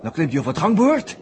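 breathless live commentary. Acted. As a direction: cheer him on as it happens. Opgewonden verslag van de klim. (de verteller doet luid en opgewonden verslag van Joosts sprong)

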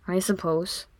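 a soft, reluctant mumble; mumbled. A narrator's reading. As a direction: grudgingly mumble it. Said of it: "he mumbled".

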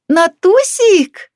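high, drawn-out holler; gleeful shout. A narrator's reading. Older woman spots old neighbour in canteen, shouting. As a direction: call out loudly across a room. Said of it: "закричала она".

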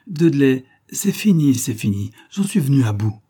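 high, loud call of effort. Acted. Wife struggling with the lock, calling loudly. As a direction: shout through the door as the lock gives.

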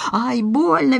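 high-pitched cry of pain; pained squeal. A narrator's reading. Doorman with his nose twisted, squealing. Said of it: "верещал тот".

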